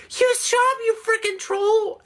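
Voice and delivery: high squeaky mock rage; mocking angry fan. Mocking the angry fan's message, high mock screech. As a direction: shriek it like a furious kid fan. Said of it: "He's like".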